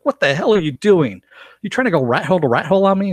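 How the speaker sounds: high, fast, outraged yelp of a woman pushing him off. Retold or imitated. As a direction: shoot it back high and fast, scandalized. Tiger rejects Josh's kiss.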